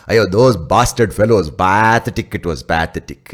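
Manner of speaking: loud, punchy Indian-English exclaiming; Indian accent. Shown as a demonstration. Indian speaker condemning those fellows, loud broad Indian accent. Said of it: "adds this flavor of curry".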